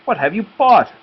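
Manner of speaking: high eager piglet voice; little piglets clamouring for treats; excited piglets. A narrator's reading. Piglets greet mother.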